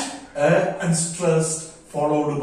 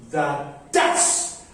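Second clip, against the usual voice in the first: low unstressed "The", then a punched "does"; two separate beats, "does" stressed; 3 semitones lower than usual. Shown as a demonstration. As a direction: drop "The" and hit "does". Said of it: "does stress".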